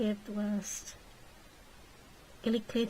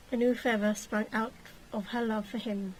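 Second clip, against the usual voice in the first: ordinary delivery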